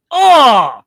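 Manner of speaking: loud, high yelp of dismay; a big hammed-up gasp of dismay; shocked oh. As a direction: blurt out startled disappointment. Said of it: "Like, I visibly".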